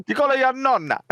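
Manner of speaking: exaggerated singsong Italian accent; worse Italian accent. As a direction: do a bad mock Italian accent. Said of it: "Like a worse Italian accent".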